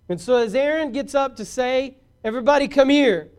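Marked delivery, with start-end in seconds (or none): 2.2-3.3 loud, high call to everybody; hollering. Acted. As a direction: holler it out over a crowd. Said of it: "he hollers at everybody".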